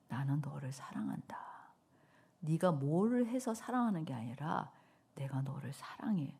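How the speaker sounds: whispered tender reassurance. Acted. Preacher voicing God's love to her, softly whispered. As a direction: breathe the words like a loving secret.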